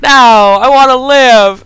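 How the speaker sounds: loud, drawn-out, high wail; hammed-up begging for his life; mock desperate plea. Adam begs the gunman for his life, mock desperate wail. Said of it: "And Adam's like".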